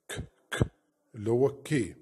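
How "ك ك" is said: ك breathed as a bare unvoiced K; K sound demo. Shown as a demonstration. Teacher showing the K sound to pupils. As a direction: puff out the K sound. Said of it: "السكند لتر حرف ك".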